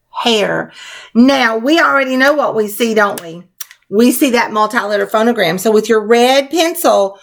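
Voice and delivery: usual speaking voice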